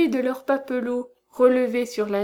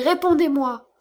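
loud, higher, pressing the demand; repeated terrified cry; 5 semitones higher, 5 dB louder than usual. A narrator's reading. Count repeats his demand to the madwoman, louder and higher. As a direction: cry it out again, shaking her. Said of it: "s'écria-t-il".

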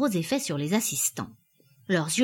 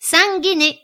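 high, loud price cry; auctioneer's shout; 10 semitones higher, 9 dB louder than usual. A narrator's reading. Auctioneer making the final offer, shouting.

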